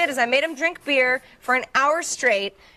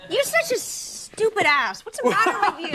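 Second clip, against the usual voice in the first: laughing mock insult; 8 semitones higher than usual. Real, not acted. Mock insult over songs.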